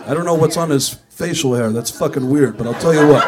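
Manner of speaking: loud opening, mockingly self-important; lightly voiced impostor rock star, punched up. Impostor answering for [name] down the hall, mock [name] voice. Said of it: "and he went".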